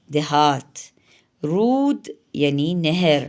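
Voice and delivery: ordinary talk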